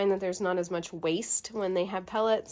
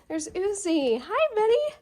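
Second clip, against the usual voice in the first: high, sweet cat-greeting voice; high pet voice; 12 semitones higher, 5 dB louder than usual. Real, not acted. Greeting the friendly cat Uzi in a high sweet pet voice.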